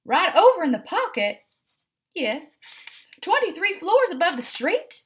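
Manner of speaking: a vain cat balking at the danger; Edith, startled. A narrator's reading. Edith alarmed at plan.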